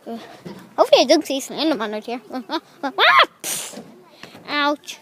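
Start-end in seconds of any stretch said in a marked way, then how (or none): 3.0-3.3 very high, loud yelp; sudden scream. Real, not acted. Startled on the walk, sudden scream. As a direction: shriek out suddenly.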